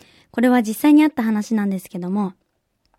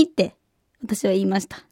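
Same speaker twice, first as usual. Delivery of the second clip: usual speaking voice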